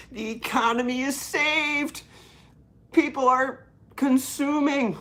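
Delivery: high, hammed-up celebration; mock jubilation. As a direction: proclaim it in over-the-top fake excitement. Mock celebration of shopping news.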